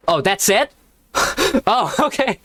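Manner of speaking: high-pitched, laughing disbelief; words breaking into giggles; amused laughter. Real, not acted. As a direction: laugh through the surprise. Reader amused by abrupt ending.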